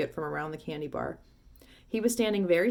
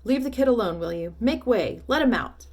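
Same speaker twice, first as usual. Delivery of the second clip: commanding shout; 4 semitones higher, 5 dB louder than usual. Acted. Shopkeeper clears crowd.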